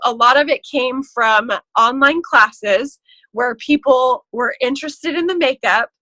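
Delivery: normal talking voice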